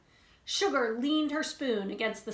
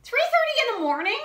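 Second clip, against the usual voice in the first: high, loud disbelieving cry; shocked cry; 13 semitones higher, 8 dB louder, faster than usual. Acted. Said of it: "cried Dirt".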